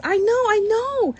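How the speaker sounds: put-on high, excited little boy; keen and knowing; lightly voiced boy; eager child.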